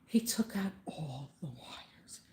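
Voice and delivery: hushed whisper as if sharing a secret. Real, not acted. Telling a friend about the repairman, in a slow whisper. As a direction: breathe it out slowly, barely voiced.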